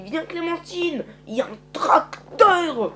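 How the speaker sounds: put-on high, drawn-out kid's call; excited calling. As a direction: call a friend over excitedly, slow and rising in volume. Excited child calls friend over.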